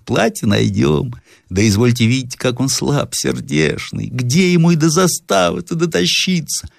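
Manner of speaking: fretting over the sick man; lightly voiced fussing old woman; pitying old woman.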